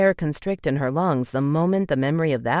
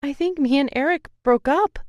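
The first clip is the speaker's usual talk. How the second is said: put-on higher, choked-up voice of a hurt girl; a girl close to tears, confessing; tearful young woman; 11 semitones higher than usual. To her brother about the breakup, voice near tears.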